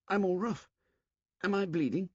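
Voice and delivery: put-on high, anxious boy's voice; a scared boy feeling his face; frightened boy. Eric asks Ralph if he is bleeding, frightened. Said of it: "pointed fearfully".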